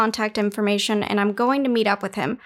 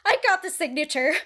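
gloating; 3 semitones higher, faster than usual. Acted. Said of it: "flexed as she gloated".

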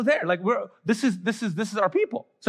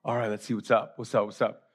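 low, soft, cocky murmur; mock cool; 10 semitones lower, 5 dB quieter than usual. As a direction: play newly confident disciples, voice dropped right down. Disciples turn cocky after miracle.

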